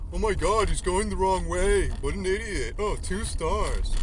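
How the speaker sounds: high, loud, snooty mock voice; ridiculing a customer rating him two stars, slowing on the last line. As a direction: sneer like a smug customer checking the app. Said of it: "one of those dumb bitches that fucking looks on their app".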